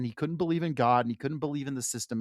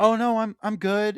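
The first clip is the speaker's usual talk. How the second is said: mock breezy brush-off; 7 semitones higher, 5 dB louder than usual. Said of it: "And he was like".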